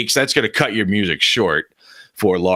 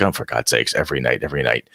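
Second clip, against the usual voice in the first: fed-up groan; 10 semitones lower, faster than usual. Acted. Older brother grumbling at his sister's whining, low and fed up. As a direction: roll your eyes and grumble it low. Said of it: "older brother is getting kinda like".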